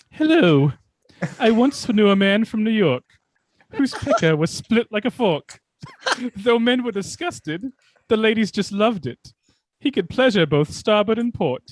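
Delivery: a plummy British storyteller putting on airs; British accent as Sanford. Acted. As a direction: recite the limerick in a hammed-up upper-crust British voice. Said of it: "Here's a little Sanford for you".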